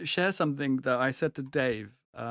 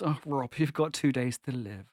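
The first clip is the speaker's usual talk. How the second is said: gentle bad news; 6 semitones lower, 6 dB quieter than usual. Imagined doctor delivering bad news.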